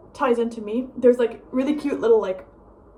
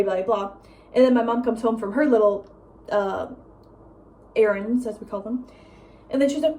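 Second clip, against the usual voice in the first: normal talking voice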